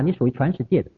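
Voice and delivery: ordinary talk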